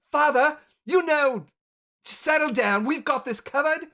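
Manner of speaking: high, loud daughterly reproof; young lady. Acted. Daughter telling father to settle down, firm and high. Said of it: "a young lady kinda comes up and says".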